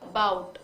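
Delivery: "about" said as "bout", the first a dropped; clipped about. Shown as a demonstration. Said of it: "about को क्या बोला था". ("about" को "बाउट" बोलें)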